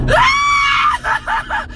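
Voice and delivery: very high, piercing shriek; terrified scream. Real, not acted. Driver startled by a loose spider, terrified scream. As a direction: scream in sudden panic. Spider scare while driving.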